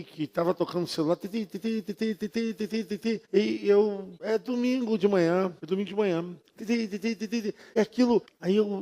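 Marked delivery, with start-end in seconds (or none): 1.2-3.2 soft high ti ti, a mimicked ringtone; quiet, high mock ringtone; phone ring imitation. 6.6-7.5 soft high ti ti, a mimicked ringtone; quiet, high mock ringtone; phone ring imitation.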